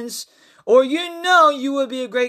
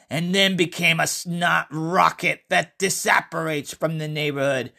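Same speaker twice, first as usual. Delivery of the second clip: story narration; 4 semitones lower than usual. A narrator's reading. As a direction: tell the tale unhurried and low. Reads his horror story aloud.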